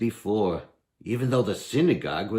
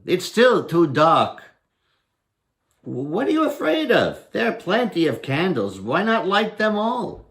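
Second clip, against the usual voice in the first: higher, louder cocky challenge; bold taunting Herschel; 11 semitones higher, 6 dB louder than usual. Acted. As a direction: goad the goblin into lighting candles.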